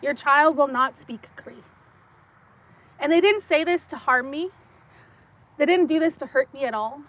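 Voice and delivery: usual speaking voice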